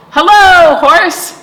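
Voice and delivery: high, loud cheery duck hello; Duck's greeting. A narrator's reading. Storyteller voicing Duck greeting Cow, high and loud. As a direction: call out brightly as Duck.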